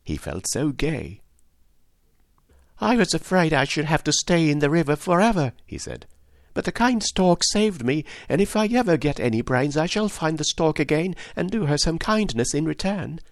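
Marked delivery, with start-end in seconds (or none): 2.8-13.2 higher, relieved storybook Scarecrow; grateful and relieved, a touch higher; lightly voiced Scarecrow. A narrator's reading.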